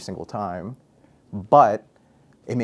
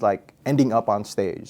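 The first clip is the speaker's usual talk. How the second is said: ordinary delivery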